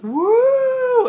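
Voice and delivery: high, loud woo; long mock crowd cheer; cheering audience. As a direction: imitate a crowd whooping. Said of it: "the audience was like".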